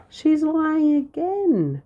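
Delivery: very high, slow, loud protest; cried out. A narrator's reading. Prince protesting over his fancy food, crying out. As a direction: wail it out high and drawn. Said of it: "cried Prince Tom".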